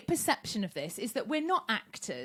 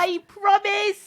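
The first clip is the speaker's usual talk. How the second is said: much higher, louder, very slow vow; hammed-up Rose; 10 semitones higher, 9 dB louder, much slower than usual. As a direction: stretch the promise like a bad soap actress. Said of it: "I'll be Rose".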